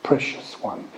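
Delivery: ordinary delivery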